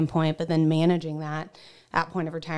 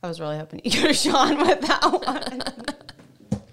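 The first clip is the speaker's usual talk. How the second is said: laughing; 8 semitones higher, 3 dB louder than usual. Real, not acted. Laughing at a joke.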